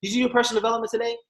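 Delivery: high, fast, sugary; chirpy preachy question. Retold or imitated. Cheery distributor asking an upset friend, sugary fast. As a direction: rattle it off brightly. Super deep question to bad mood.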